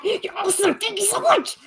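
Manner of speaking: high sing-song gushing thank-you; sugary high-pitched gratitude; cutesy thanks. Real, not acted. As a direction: gush up high like a cartoon.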